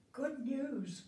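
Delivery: high, bright cry of good news from an angel. A narrator's reading. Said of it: "cried the angel".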